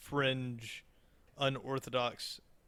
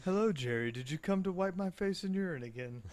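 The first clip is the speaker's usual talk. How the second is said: higher put-on voice toward the end; lightly voiced character; 5 semitones higher than usual. Speaking as Snowball to Jerry, lightly put-on voice. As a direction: lightly put on a talking-pet voice.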